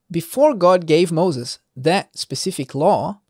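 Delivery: ordinary delivery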